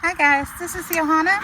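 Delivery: normal talking voice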